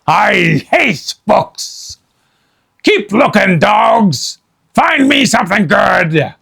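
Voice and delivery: slow, loud grumbling orders; Captain Bones ordering his men; pirate captain. Acted. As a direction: snarl orders at the crew.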